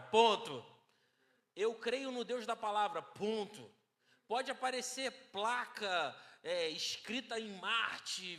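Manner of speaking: full preaching voice with punches on key words. Acted. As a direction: drive the point home to the church. Preaching to congregation.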